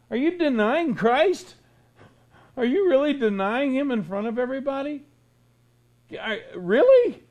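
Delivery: rising accusing questions; indignant challenge. Real, not acted. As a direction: confront them, voice up. Preacher confronting listeners.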